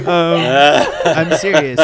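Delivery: talking through real laughter; can't stop laughing, loud and high. Real, not acted. Laughing through his own words.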